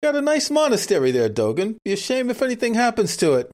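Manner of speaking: put-on light New York wise-guy accent with a veiled threat; a hint of a New York gangster shaking someone down; mock New York mob protection pitch; New York mobster accent. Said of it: "not appreciating our accents".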